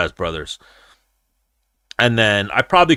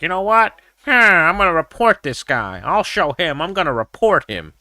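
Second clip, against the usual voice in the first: higher-pitched whiny mocking of a tattletale; mock a petty snitch, pitched up; 7 semitones higher than usual. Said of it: "is be like".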